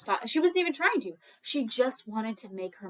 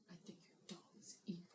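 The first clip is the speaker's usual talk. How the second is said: quick, hushed whisper; quiet aside to oneself; 5 semitones lower, 28 dB quieter, much faster than usual. Retold or imitated. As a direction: whisper it quickly under your breath. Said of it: "quietly said to herself".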